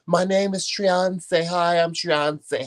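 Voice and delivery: high, slow mock-diva introduction; playful diva. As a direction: introduce yourself like a star, hammed up.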